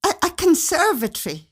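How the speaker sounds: wife gushing in breathless excitement; spurted out. A narrator's reading. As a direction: blurt it out, tripping over the a's. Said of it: "she spurted out".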